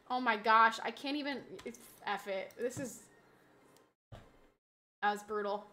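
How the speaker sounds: ordinary delivery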